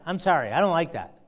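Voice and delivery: ordinary talk, nothing marked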